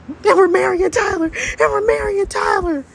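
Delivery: very high, stretched exclamation; high-pitched overwhelmed cry; hysterical squeal. Acted. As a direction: squeal the news frantically. Shocked discovery of the groom.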